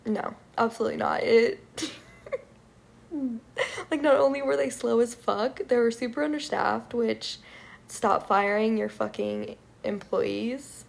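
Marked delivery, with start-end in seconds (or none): none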